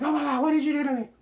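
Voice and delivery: high, loud, fast panicked cry; freaking out. Retold or imitated. Woman freaking out at what was done, high fast panicked shriek. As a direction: shriek in panic, rushing the words. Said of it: "she freaked out".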